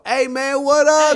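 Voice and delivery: loud, high hammed-up gangster greeting; gangster dog. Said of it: "was a gangster though".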